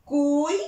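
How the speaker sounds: "cuidado" split into cui, da, do with long pauses; syllable by syllable. Shown as a demonstration. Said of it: "puede ser I U o U I".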